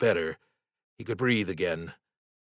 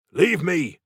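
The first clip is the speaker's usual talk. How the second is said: sharp high shout, much louder; 9 semitones higher, 5 dB louder than usual. A narrator's reading. Paddy shouting at Stanley to leave him, loud high cry. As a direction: bark it out to send him away. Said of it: "he shouted".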